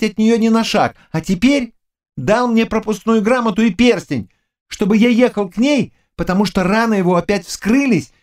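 high-pitched, excitable youth; agitated young servant. Acted. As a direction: protest in a high, boyish servant voice. (взволнованный юный слуга высоким голосом)

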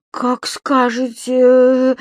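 very slow, unsure reply; secretary hesitating at the door; hesitant. Acted. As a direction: drag it out, not wanting to leave. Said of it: "замялась она".

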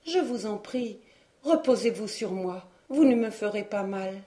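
weak, spent words let out on a breath; faint breathy voice. A narrator's reading. Hermine to her wounded rescuer, faint and breathy. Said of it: "sa voix n'était plus qu'un souffle".

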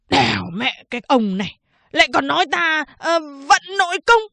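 rising loud, high angry retort; exasperated prisoner. Acted. Prisoner snaps back at the barber's excuse, rising angrily.

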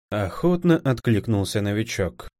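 plain reading voice

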